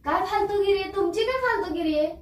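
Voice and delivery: high, put-on indignation; indignant retort. Wife overreacting to husband's complaint as a prank, indignant.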